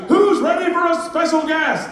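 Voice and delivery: high, loud luau host shout; hype announcer. Retold or imitated. Luau host introduces the special guest, shouted announcer voice. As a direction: fire up the crowd for a guest.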